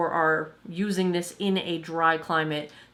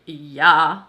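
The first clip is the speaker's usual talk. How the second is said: bored student; 3 semitones lower, 8 dB louder than usual. Retold or imitated. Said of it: "the twenty-something look of".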